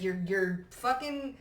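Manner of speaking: ordinary talk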